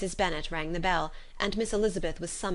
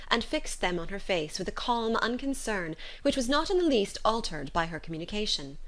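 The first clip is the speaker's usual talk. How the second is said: ordinary talk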